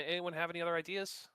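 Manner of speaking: ordinary delivery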